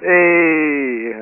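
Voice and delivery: usual speaking voice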